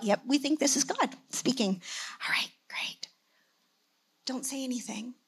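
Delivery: soft, breathy whispered secret; secretive whisper. Retold or imitated. Pastor whispering the family's quiet confirmation, hushed secret. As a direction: keep it hush-hush.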